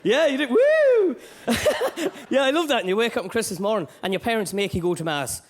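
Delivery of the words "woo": high, loud cheering whoop; a sudden high whoop of delight; hammed-up woo; mock whoop.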